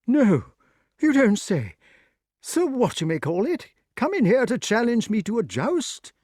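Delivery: high, astonished bluster; surprised old knight. Acted. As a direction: react in disbelief to the news. King hears of challenge.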